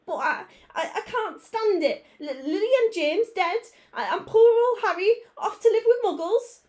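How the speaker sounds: high, loud wailing grief; sobbing. A narrator's reading. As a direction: wail through the tears. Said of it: "sobbed Hagrid".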